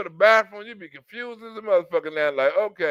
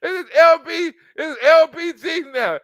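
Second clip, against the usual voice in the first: higher and louder, hammed-up alarm; mock outrage; 6 semitones higher, 5 dB louder than usual. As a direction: announce the disaster in mock panic.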